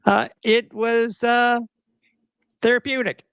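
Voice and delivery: high, slow ironic tone; mock dramatic. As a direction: say it like a mock confession. Mock comic delivery.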